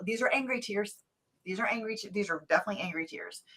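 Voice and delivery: mock tearful anger, dropping to a whisper; angry tears. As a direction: insist softly, fading into whispered retold upset. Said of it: "really angry and I just need to cry".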